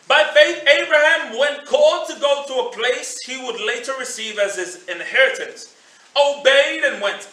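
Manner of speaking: scripture reading. Acted. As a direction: read scripture out loudly and deliberately.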